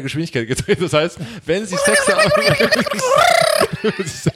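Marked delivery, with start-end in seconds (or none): 2.3-3.8 high squeaky laugh. Pretend. Helium laugh demo.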